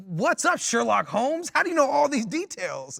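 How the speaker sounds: high-pitched, disbelieving jab; mock incredulous teasing. Teasing roommate over his detailed description.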